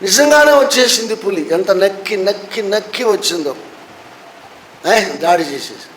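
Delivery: ordinary talk, nothing marked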